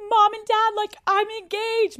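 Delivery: high-pitched squeal of news; excited announcement. Retold or imitated. Telling her parents of the engagement, high excitement. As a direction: break big news in a high voice.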